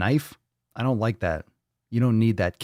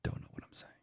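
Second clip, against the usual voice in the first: whisper; 20 dB quieter, faster than usual. Real, not acted. Aside to himself.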